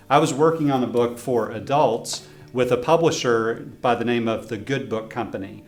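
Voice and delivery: usual speaking voice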